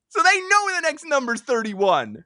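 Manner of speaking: high, insistent protest; high-pitched exasperated insistence; heated arguing. Real, not acted. Mock quarrel over the number.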